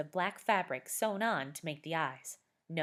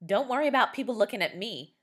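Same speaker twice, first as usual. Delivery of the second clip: fast, louder, irritated snap; snapping; 5 semitones higher, 7 dB louder, faster than usual. A narrator's reading. Scott mocking his sister's fairy costume, fast irritated snap. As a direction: bite back quickly. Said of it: "Scott snapped".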